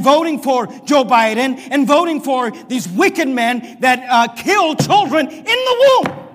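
loud, fiery pulpit preaching, slowing to hammer points; preaching at full force. Real, not acted. Pastor denouncing abortion to his church, preaching at full force. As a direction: rail at the congregation from the pulpit.

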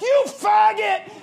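high, loud, slow shout; a preacher re-enacting a slow, high, loud shout; shouted sermon style. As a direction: stretch it out like a preacher's cry. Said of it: "like, preaching a sermon".

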